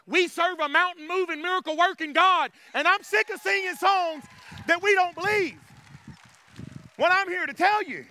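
high, driving preacher's delivery; fervent preaching. Real, not acted. Preacher sick of unbelieved songs, fervent preaching. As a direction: declare it with pushed-up voice.

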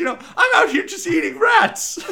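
high-pitched, shouted rant; Charlie from Always Sunny. Acted. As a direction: yell it as a hammed-up, worked-up loser. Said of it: "like, Charlie from Always Sunny".

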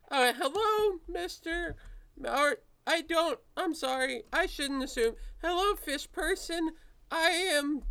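high-pitched, awkwardly formal nervous greeter; Philip. Acted. Nervous man greets a bird person in the hall, high and halting. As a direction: pitch it way up and stumble over the greeting. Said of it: "he stops and says".

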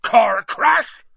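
huge, high-pitched angry bellow; outraged roar. A narrator's reading. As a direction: roar it in disbelief. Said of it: "roared Hagrid".

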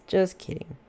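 usual speaking voice

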